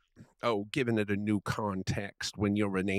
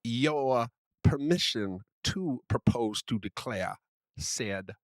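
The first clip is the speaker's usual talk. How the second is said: put-on Southern gentleman accent. Friend keeps up the Southern gentleman bit, mild Southern accent. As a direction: grandly declaim as a genteel Southern gentleman. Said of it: "I must use this voice".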